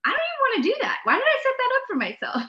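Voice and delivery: high, whiny mock complaint to herself; a high, exasperated voice imitating her past self; whiny inner voice. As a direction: squeak it out like you regret planning it. Retelling her own reluctant thought.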